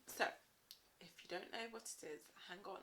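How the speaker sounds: ordinary delivery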